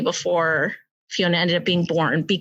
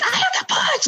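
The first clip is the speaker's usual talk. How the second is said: high shouted urgency; imitating her screamed demand in labor; mock scream. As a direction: call out I have to push sharply. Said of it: "Like, I screamed it".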